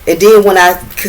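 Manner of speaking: ordinary delivery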